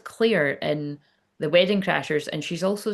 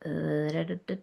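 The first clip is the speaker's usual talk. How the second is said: place-finding "da-da"; 2 semitones lower, 7 dB quieter than usual. Real, not acted. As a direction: idly sound out syllables while searching. Finding her place on the card.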